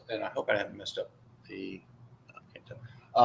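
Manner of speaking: whispered aside to herself, unvoiced; muttered aside. Real, not acted. Speaker worried about the slides, whispering to herself. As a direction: drop to a whisper while checking the slide.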